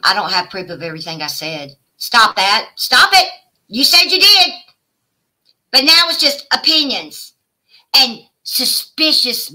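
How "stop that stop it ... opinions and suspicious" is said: sharp, much higher and louder; snapping back at Paige in anger; angry yelling. Real, not acted. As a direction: shout her down. Arguing with rival Paige.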